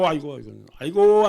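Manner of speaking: loud keening aigo cry; mourning wail. Shown as a demonstration. Pastor demonstrating a funeral wail to the congregation, loud keening. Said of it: "아이고는".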